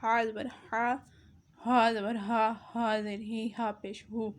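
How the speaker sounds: second ہا زبر ہا breathed heavier from the throat; throaty ح against light ہ; two ha sounds. Shown as a demonstration. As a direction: contrast the two h letters. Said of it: "ہا اور ہا میں فرق".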